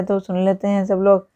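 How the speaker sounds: ordinary delivery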